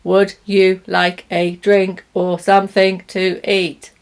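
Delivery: each word carefully separate; robotic textbook. Shown as a demonstration. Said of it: "a robot who says the words like that".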